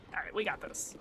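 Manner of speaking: hushed game whisper. Real, not acted. Creeping toward enemies, whispering to self. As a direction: breathe it so enemies won't hear.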